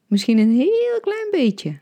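high, slow, teasing half-laugh; slow teasing lilt with a laugh in it; playfully laughing. A narrator's reading. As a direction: make it sound like a light joke. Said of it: "zorgde ervoor dat het lachend genoeg klonk".

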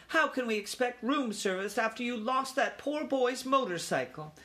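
high-pitched, scolding question; indignant mother mouse; exclaiming mother. A narrator's reading. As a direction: reproach your son in a high voice. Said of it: "exclaimed his mother".

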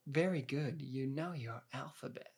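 lightly mimicked condescending praise; a hint of a patronising pat on the head. Vlogger mimicking the actress's condescending praise to Cage, mildly. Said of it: "How's the condescending note on her".

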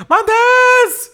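high, loud mock girl's yell; girl yelling a name. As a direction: belt the name like a girl across a room. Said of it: "she comes up to you and she's like".

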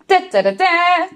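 high, drawn-out trumpet fanfare; vocal bugle call, last note held; imitated trumpet. A narrator's reading. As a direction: toot it out like a brass trumpet. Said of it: "begon te spelen".

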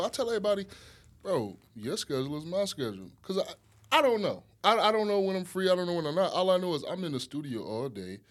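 normal talking voice

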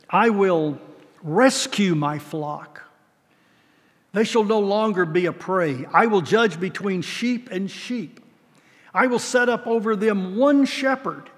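solemn, deliberate reading; scripture reading. Acted. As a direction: lay out God's promise slowly. Reads scripture in sermon.

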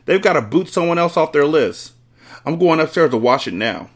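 shouting. A narrator's reading. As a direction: order it as the father springing into action. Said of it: "the father shouted".

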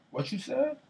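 high-pitched, incredulous comeback; mock affronted challenge. Friend imitating a thin-skinned player answering trash talk, mock affronted. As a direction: jump up in pitch, imitating someone taking offense. Acting out someone easily offended.

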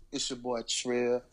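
slow, deep radio seduction; low sexy voice. Pretend. Greeting the ladies on the podcast, low sexy voice. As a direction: croon to the listeners, low and sensual. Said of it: "I thought I was talking a little low".

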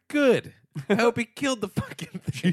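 high, gleeful mock cheer; savagely pleased. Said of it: "it was just like".

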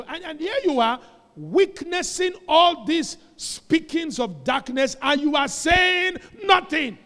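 high, slow shouted preaching; rousing rebuke. Real, not acted. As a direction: cry out in reproach at their silence. Preacher rebukes silent congregation.

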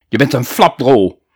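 put-on loud, booming insult at the boy; bellowing teacher. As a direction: roar it at the new pupil. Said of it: "brulde meester Bulder". (brullende meester)

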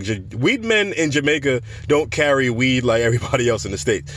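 ordinary talk

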